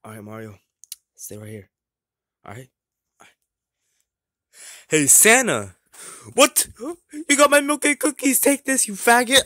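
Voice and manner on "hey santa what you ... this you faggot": loud, high attacking yell; confrontational shouting. Acted. Attacker confronts Santa over milk and cookies, shouting. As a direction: shout as you charge in swinging.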